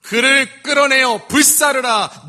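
slow, loud, high raging order; furious command. Acted. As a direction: roar the death sentence slowly. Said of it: "유다가 뭐라고 말한다고요?".

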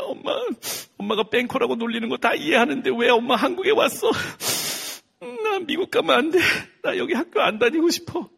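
put-on high, loud whiny child voice; crying child pleading. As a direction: beg mom to go back to America. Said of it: "막 그래요". (울며 조르는 높은 아이 목소리)

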